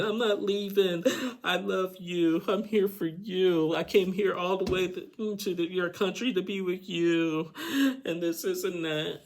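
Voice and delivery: hammed-up high begging voice; begging girlfriend. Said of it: "she's like begging".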